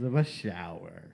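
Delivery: put-on southern accent. Co-host explains his accent ban at home, southern accent. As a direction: play up a southern accent, unhurried. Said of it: "I can only do this accent".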